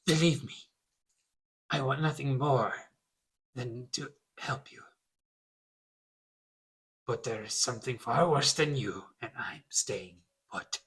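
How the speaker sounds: stubborn NPC who won't budge; gravelly innkeeper. Acted. NPC bartender voice.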